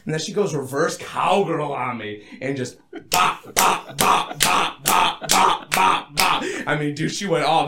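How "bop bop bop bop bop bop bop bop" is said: punchy, repeated percussive bops; imitated bopping noise. Storyteller demonstrating her motion, rhythmic bop sounds. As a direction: pop out each bop in steady rhythm.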